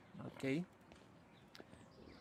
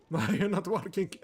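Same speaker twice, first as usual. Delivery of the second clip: laughing through the line, much louder; can't hold back the giggles while reading; 5 semitones higher, 5 dB louder than usual. Real, not acted. Player laughs while reading a monk's scolding line.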